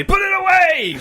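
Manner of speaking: high, loud mock outrage; scandalised shout. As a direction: shriek at her to cover up.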